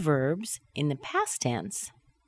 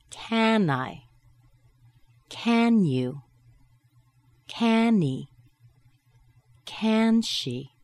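"Can" held high, pronoun dropping; falling intonation; 5 semitones higher, much slower than usual. Shown as a demonstration. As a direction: hold CAN high and full, then fall. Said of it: "with a falling intonation".

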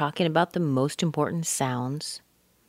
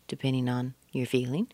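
ordinary talk

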